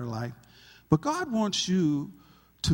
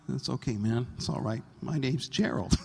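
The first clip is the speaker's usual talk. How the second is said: calm reassurance; 6 semitones lower than usual. Retold or imitated. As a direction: settle him down kindly. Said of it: "I'm like".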